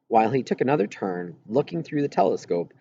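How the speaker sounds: ordinary delivery, nothing marked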